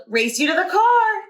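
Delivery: high, eager dare to race; thrilled kid's challenge; excited challenge. A narrator's reading. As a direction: call it out bouncing with excitement. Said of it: "she yelled".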